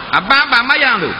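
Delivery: mock wife in alarm. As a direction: ask in a panic. Wife's alarmed reply.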